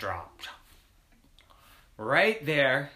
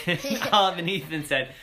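ordinary delivery, nothing marked

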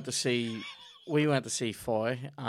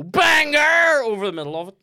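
huge high yell over the podcast; shouted; 13 semitones higher, 13 dB louder than usual. Pretend. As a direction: yell it to wreck the recording. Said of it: "I'm gonna just fucking go".

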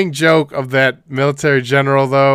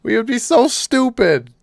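mock dumb voice; 10 semitones higher than usual. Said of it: "I'm like".

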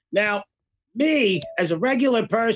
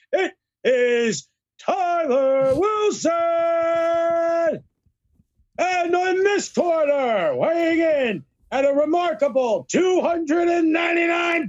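Bruce Buffer impression; 5 semitones higher than usual. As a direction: introduce the fighters like an arena announcer. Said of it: "my Bruce Buffer impression".

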